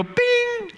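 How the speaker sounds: high imitated phone ding; playful high bing; phone ping sound. Shown as a demonstration. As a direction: chime it like a text alert. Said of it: "you go".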